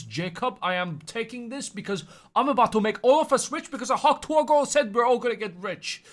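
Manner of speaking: high, loud hammed-up father; a dad promising his son riches; excited father hyping up Jacob; mock excited dad. Dad voiced to son in story.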